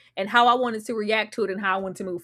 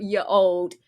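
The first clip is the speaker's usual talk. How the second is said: ordinary talk, nothing marked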